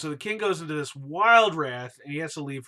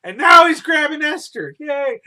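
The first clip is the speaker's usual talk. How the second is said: a furious shout, much higher and louder; king yelling; 12 semitones higher, 15 dB louder than usual. Retold or imitated. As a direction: yell it as the enraged king. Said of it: "And the king starts yelling".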